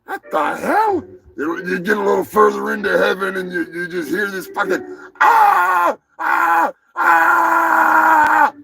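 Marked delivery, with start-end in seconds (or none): 0.0-1.0 much higher, startled double take; shocked disbelief. Retold or imitated. New arrival at heaven's gates, high shocked squeak. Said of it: "You're like". 5.2-8.5 high, loud screaming bursts; Sam Kinison scream. Retold or imitated. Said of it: "it's Sam fucking Kinison".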